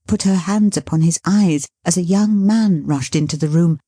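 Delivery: usual speaking voice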